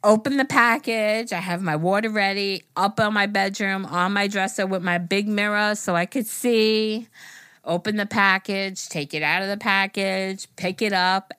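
usual speaking voice

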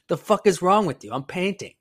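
loud, high, retold exasperation; exasperated retort. Painter brushes off drunk girl, loud exasperated retort.

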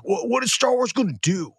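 high-pitched mock-panicky fan voice; fretting fans. Co-host mimicking everyone waiting on Star Wars, mock fretting. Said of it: "everyone's sitting here being like".

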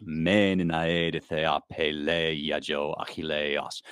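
slow chanted Greek with long syllables held; rhythmic recitation. Shown as a demonstration. Reciting Homer's first line to show its rhythm, slow and chanted. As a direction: recite "Me ninae de thea pele iajo achilleos" slowly, holding each long syllable so the beat can be heard. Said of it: "you can hear already it's in a kind of bump".

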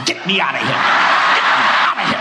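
desperate half-shouted plea; mock panic. Preacher begs God for rapture, mock panic. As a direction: beg to escape, hammed-up.

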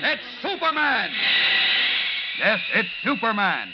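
high, loud booming radio announcer; announcer intro. Acted. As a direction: build the excitement like a serial opening. Radio show opening announcement.

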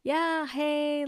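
high, perky hey; the cheerful reply you couldn't manage; peppy greeting. Retold or imitated. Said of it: "could not even be like".

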